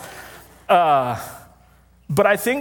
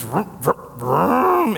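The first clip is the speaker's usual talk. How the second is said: engine revving up; 4 semitones higher, much slower than usual. Retold or imitated. The air conditioner finally roaring to life, a rising vroom. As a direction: rev the motor up until it catches. AC powers up after prayer.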